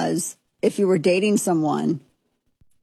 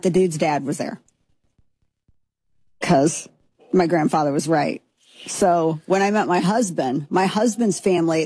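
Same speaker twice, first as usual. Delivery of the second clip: ordinary delivery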